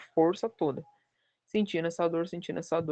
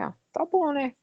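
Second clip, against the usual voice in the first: surprised ah tá bom; 10 semitones higher, 3 dB louder than usual. Retold or imitated. Told she needs surgery.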